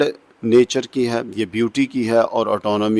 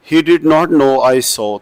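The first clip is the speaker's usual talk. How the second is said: recited line; 3 semitones higher, much slower than usual. Shown as a demonstration. Teacher recites second line.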